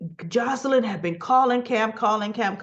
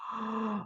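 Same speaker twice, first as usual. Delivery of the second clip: gasp; 11 dB quieter than usual. Real, not acted. Tense scene reaction.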